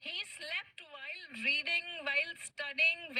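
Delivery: very slow, word by word; slow dictation. Shown as a demonstration. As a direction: read it out slowly for the students. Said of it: "कैसे sentence बनाएंगे?". (धीरे धीरे लिखवाना)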